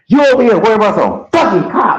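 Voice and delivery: high, fast angry jab; cussing. Retold or imitated. Roommate cussing at the speaker over the cops, fast and angry. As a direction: spit it out mocking him, fast and sharp. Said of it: "then he cussing".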